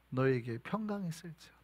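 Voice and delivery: soft whispered comfort; whispered blessing. Acted. Jesus comforting, repeated whispered. (제자들에게 속삭이듯 전하는 평강의 축복)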